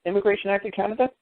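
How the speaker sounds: sarcastic echo of the officer's excuse; mocking disbelief. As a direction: toss the name back incredulously, up high. Mocking the agent's claim.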